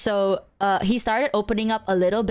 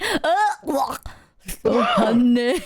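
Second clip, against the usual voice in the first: slurred and louder on "so honey"; mock mouthful; 3 semitones higher, 3 dB louder, slower than usual. Copying the video guy's honey bite, slurred. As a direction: push "so honey" out through honey. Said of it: "he went".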